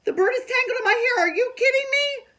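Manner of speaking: very high, loud screech; a panicked girl screeching at her parents. Acted. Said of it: "I raised my screech even louder".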